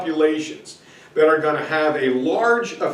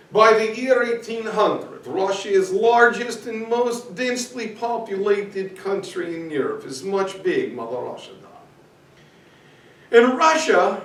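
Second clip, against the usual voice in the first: mock Russian accent; 3 semitones higher than usual. Shown as a demonstration. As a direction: ham up a Russian accent for a class. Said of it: "I'll stop using my accent".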